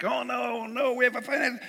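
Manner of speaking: high, panicky rush of alarm; hammed-up money worry; mock panic. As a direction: act out the worrier's sudden dread. Mock panic over money setback.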